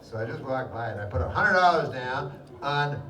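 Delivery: normal talking voice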